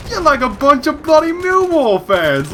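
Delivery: high, slow, hammed-up English accent; mock English accent. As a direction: sneer like an angry English football fan. Mocking the English again.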